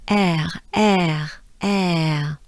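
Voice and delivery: air, drawn out and French. Shown as a demonstration. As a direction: air ending in a French throat R. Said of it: "there is the French R again".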